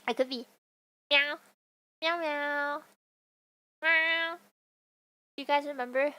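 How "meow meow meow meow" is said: imitated cat meows, held on a steady note; playful drawn-out meowing. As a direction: meow like a pretend cat. Playing a cat.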